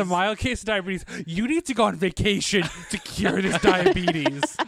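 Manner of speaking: a hint of a snooty doctor prescribing a cure; lightly hammed-up doctor's advice; mock diagnosis voice. Mocking the movie's cure, as a mock doctor.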